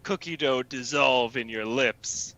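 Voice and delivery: low, lingering mock-seductive voice; creepy coaxing. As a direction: coax them in a low creepy tone. Creepy coaxing in improv scene.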